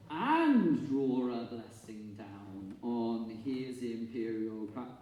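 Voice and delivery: "and" drawn out and leaned on, whole line very slow; sing-speak it slowly, leaning on "and"; stress on "and". Shown as a demonstration. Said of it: "you get one".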